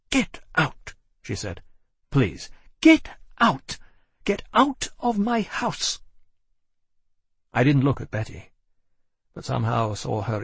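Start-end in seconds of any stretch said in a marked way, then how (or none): 0.1-6.0 high, slow, final order to leave; pushing each word out, shaken with anger; get out. A narrator's reading. Caroline throws him out.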